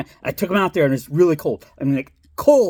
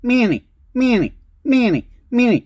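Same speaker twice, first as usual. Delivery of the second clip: rising louder calls of "Manny"; calling a runaway dog, building louder; 6 semitones higher, 4 dB louder than usual. Retold or imitated. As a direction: call out across a cold park. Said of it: "I'm like".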